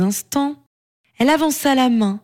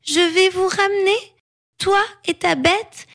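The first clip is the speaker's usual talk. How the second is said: put-on high-pitched child apologising; sorry child, pitched high; penitent giant girl; 8 semitones higher than usual. A narrator's reading.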